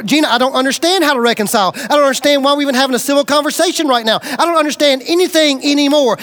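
fast, bewildered outpouring; confused rush. Retold or imitated. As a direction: rattle it off, lost and overwhelmed. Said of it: "my feelings were".

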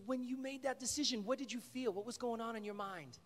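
high, eager imitation of an excited leader; fired-up questioning. Fired-up counselor questioning a shy boy, excited. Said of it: "he was all fired up".

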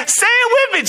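high-pitched acted urging; excited urging. Acted client eggs agent on.

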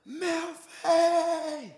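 calling a name across the room, high and strained; a held-back shout of a name; mock shout. Shown as a demonstration. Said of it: "I won't shout 'cause I'll upset you all".

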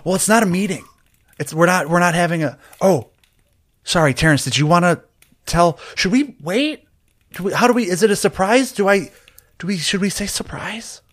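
high, stumbling run of questions; flustered, excited character. Acted. Asking Terrence about the surprise, high and flustered. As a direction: trip over the words in a high, eager voice.

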